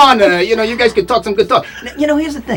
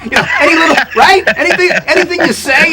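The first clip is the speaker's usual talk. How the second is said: real laughter breaking through the words; laughing while talking; 7 semitones higher than usual. Real, not acted. Joking to Neville about needing a lawyer, laughing. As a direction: chuckle through it, pitch lifted.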